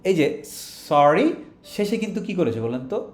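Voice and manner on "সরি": "সরি" rising as a question; rising one-word question. Shown as a demonstration. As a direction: ask "সরি" with a lift. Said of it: "টোনটা rise করেছে".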